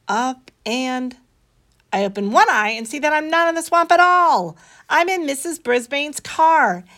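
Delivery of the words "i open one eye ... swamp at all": usual speaking voice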